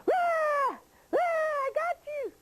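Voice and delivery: very high, drawn-out storybook shriek; triumphant witch pounce. Acted. Witch jumps out of the woods at the bear, shrieking triumphantly. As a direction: pounce with a squealed, gleeful gotcha.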